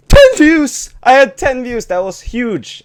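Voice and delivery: high, loud, thrilled cry; excited shout. Retold or imitated. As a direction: yell it like your first big win. Joy at first ten views.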